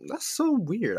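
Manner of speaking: baffled. Retold or imitated. Puzzled by his friend ignoring him, high incredulous. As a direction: squeak it out in disbelief. Reacting to friend's silent treatment.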